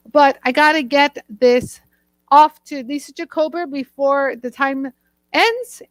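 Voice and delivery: ordinary talk, nothing marked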